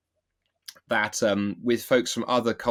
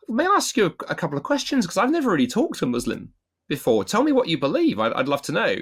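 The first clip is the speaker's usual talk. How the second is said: curious asking; 7 semitones higher than usual. Shown as a demonstration.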